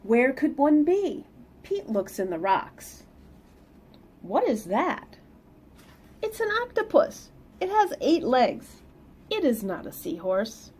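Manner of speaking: lively read-aloud storytelling; picture book reading voice; story narration. Acted. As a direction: read it to the kids with bounce.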